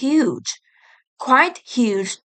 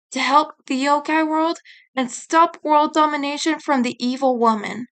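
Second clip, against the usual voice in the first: plain reading voice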